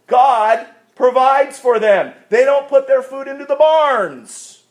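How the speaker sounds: high, slow, forceful proclamation; emphatic preaching. Real, not acted. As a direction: preach it out, leaning on key words.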